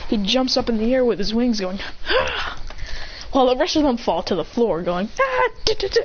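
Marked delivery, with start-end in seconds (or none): 2.1-2.6 mock yell. Yell while jumping into air. 5.2-6.0 high yelp breaking into stutters; mock falling yelp. The rest fall to the floor, mock falling yelp.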